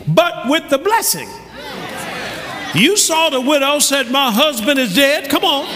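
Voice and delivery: loud, high preaching at full force; rousing pulpit delivery. Acted. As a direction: drive it home to the crowd. Preaching the blessing to congregation.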